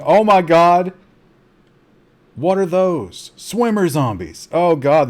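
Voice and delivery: loud, high-pitched panic at the game; startled gamer's cry of alarm; alarmed outburst. Real, not acted. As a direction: blurt it out as a sudden horde appears. Sudden horde appears in zombie game.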